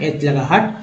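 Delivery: ordinary talk, nothing marked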